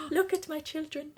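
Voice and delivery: high, soft doting coo; doting parent voice. Pretend. Showing off plushies to the camera, high doting coo.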